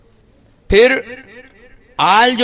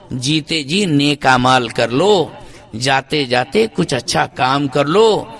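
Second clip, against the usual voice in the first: slow rhyming counsel; 4 semitones lower, slower than usual. Acted. Chanted rhyming advice.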